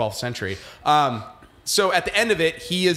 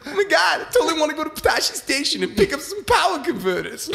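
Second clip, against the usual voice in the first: much higher, whiny Travolta voice; John Travolta as Luke; 12 semitones higher than usual. Pretend. High whiny Travolta voice, doing Luke for laughing friends. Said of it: "John Travolta as Luke Skywalker".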